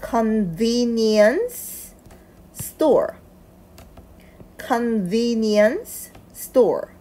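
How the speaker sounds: "convenience" stretched out very slowly, syllable by syllable; slow word practice. Shown as a demonstration. Teacher models the hard word for learners, very slowly. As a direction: spell the word out in sound for beginners. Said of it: "편의점이라는 단어를 먼저 읽어보겠습니다".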